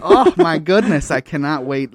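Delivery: gushing excitement. Retold or imitated. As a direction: gush like a thrilled fan. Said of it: "It's just like".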